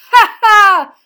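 loud, high acted "ha ha"; big hammed-up guffaw; great laugh. A narrator's reading. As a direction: burst out with a triumphant shouted laugh. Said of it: "of laughter".

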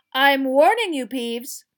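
loud, high, stern warning; shouted warning. Acted. Teacher warning the poltergeist, angry shout. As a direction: shout it, easing off on the name. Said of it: "shouted Missus Professor McGonagall".

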